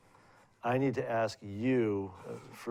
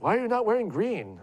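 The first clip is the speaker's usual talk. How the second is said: put-on higher-pitched, louder old landlady voice; elderly landlady; 11 semitones higher, 5 dB louder than usual. Old landlady scolding her tenant about green, pitched up and louder.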